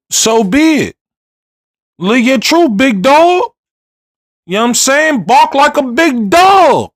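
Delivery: hype shout. Real, not acted. Hyping up, calling out.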